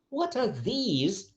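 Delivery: THESE high, soft and drawn out; emphasis on THESE. Shown as a demonstration. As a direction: slowly lift THESE. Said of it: "changing intonation and changing a bit, uh, the emphasis".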